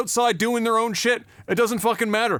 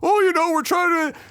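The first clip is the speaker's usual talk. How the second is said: mocking protesters; 11 semitones higher than usual. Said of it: "people are looking up at him like".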